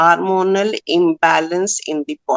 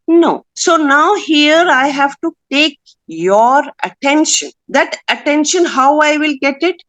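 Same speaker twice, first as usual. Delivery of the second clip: grab their attention with authority; voice of conviction; 6 semitones higher than usual. Shown as a demonstration. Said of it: "When there is conviction in my voice".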